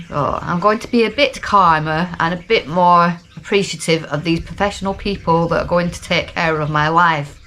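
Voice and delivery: ordinary talk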